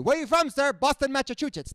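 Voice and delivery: put-on high, loud Cuban-accented English; mock Cuban accent; imitating dad's Cuban accent. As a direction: say it like a Cuban dad faking local. Dad's accent asked origin.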